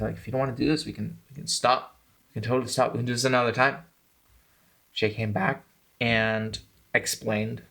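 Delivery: ordinary delivery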